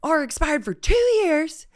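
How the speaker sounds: high, loud mocking cop voice. Cop lists friend's expired papers, high mock scold. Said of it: "He was like".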